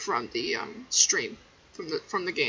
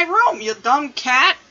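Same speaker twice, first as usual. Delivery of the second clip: mock scolding; 4 semitones higher, 8 dB louder, slower than usual. Real, not acted. Scolding the cat.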